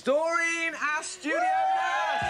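host unveiling the champion, shouted high and slow; excited big reveal. Acted. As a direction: sell the surprise with a huge slow yell. Presenter revealing the surprise opponent.

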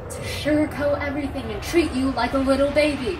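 fed-up survivor yelling at the one she saved; angry scolding. Acted. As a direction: snap at someone you just rescued who keeps pushing ideas. Survivor scolds the rescued listener.

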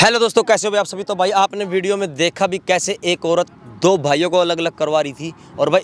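forceful sign-off to camera; video outro address. Real, not acted. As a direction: address the viewers firmly and loudly. Presenter's outro addressed to viewers.

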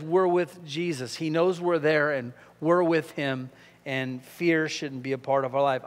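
normal talking voice

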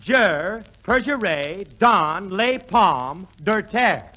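slow, careful schoolboy French. Shown as a demonstration. Showing off his night-school French to the boss, slow and careful. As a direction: spell out the French syllable by syllable, slow. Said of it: "Get this".

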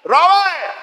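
llamada aguda y alargada a Roberto; grítale desde la calle; llamando a gritos. Acted. (llamando a Roberto a lo lejos)

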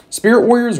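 surprised yelp at the price; startled exclamation. Real, not acted. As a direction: pitch jumps high and loud.

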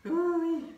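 high strained cry of effort; strained yelp. Real, not acted. End of hard set.

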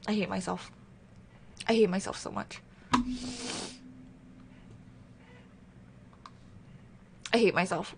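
very soft, low and defeated; small deflated voice; quiet self-reproach. Real, not acted. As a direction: mutter it to yourself, mortified. Mortified at having been muted.

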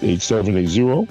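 ordinary talk, nothing marked

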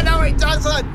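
high, loud protest; anguished loud cry; dismayed shout. Real, not acted. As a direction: yell in dismay. Conceding a goal.

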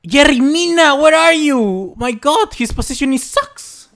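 high, loud exasperated yell; high furious yelling. Real, not acted. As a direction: scold the defender through the screen. Yelling at defender's positioning.

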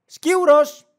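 high, loud burst of distraction; sudden excited shout. Acted. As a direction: yell it like a dog spotting prey. Acted dog spots a squirrel. (ξαφνική ενθουσιασμένη κραυγή)

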